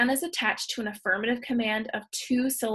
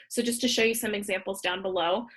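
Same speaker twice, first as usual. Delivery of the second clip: ordinary delivery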